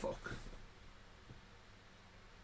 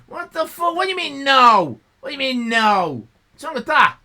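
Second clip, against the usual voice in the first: indignant outburst; 8 semitones higher, 10 dB louder than usual. Real, not acted.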